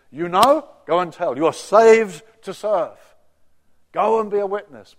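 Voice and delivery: loud, punchy preacher's call; mock preacher. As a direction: ham up a pushy preacher telling them to go. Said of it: "this sermon preached".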